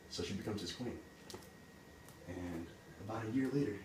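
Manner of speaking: ordinary delivery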